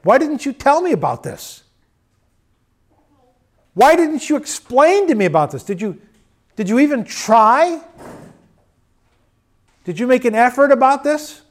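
pleading friend. Acted. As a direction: plead with a lost friend who was never told. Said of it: "looks over at you and says".

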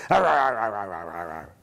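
low growling "arr" noises for angry muttering; low mock growl of frustration; mock grumbling. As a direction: grumble like you just hung up on someone. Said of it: "had some choice words".

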